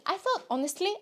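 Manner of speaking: usual speaking voice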